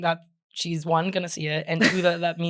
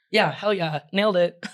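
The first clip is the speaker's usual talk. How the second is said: ordinary talk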